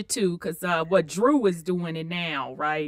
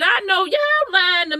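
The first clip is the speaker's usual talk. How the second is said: incredulous; 11 semitones higher, 4 dB louder than usual. Retold or imitated. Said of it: "I said".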